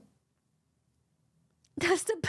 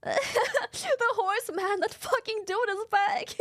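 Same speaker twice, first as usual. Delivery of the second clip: higher, laughing exasperated horse; mock horse voice; 7 semitones higher, slower than usual. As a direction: play the fed-up horse, pitched up. Horse complaining in the story.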